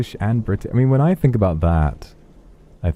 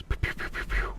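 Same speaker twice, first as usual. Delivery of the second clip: zapping noises; 11 dB quieter than usual. Pretend. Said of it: "I'm gonna fight your stress".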